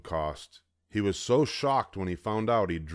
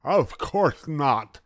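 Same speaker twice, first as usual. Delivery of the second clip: put-on high, slow, loud gummy old man; distressed toothless grandpa; 7 semitones higher, 6 dB louder, much slower than usual. A narrator's reading. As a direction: mumble it like a grandpa without teeth.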